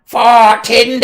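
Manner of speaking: high, drawn-out kobold war cry; screaming kobold. Acted. Kobold rushing at the party with a dagger, screamed battle cry. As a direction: scream it as you charge. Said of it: "another kobold comes screaming".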